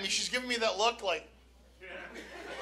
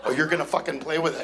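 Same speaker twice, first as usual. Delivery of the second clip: low, fast threatening growl-back; menacing dad retort; 9 semitones lower, faster than usual. Retold or imitated. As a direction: drop low and lay down the law. Angry father's retort to child.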